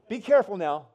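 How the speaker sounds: loud mock warning to the crowd; warning shout. Preacher warns the man on the back wall, loud. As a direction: call it out like a warning.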